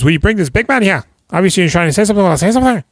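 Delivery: a lightly voiced heavy picking a fight; tough guy taunt. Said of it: "And he goes".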